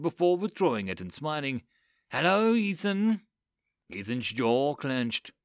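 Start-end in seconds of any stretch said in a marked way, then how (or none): 2.1-3.2 cold, knowing hello; sinister greeting. A narrator's reading. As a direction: greet him with menace. Lilith greets Ethan.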